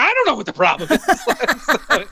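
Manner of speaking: high, loud, slowed helpless echo of the director; squeaky mock-bewildered repeat. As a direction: throw his words back, high and baffled. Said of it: "And I was like".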